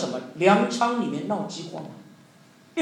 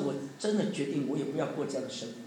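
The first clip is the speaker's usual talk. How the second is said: quiet sad resolve; 6 semitones lower, 7 dB quieter than usual. Retold or imitated. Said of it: "那天他是非常难过".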